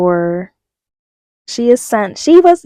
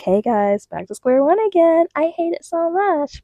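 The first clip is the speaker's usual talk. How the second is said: mock vlogger whine; 9 semitones higher than usual. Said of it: "I'm like".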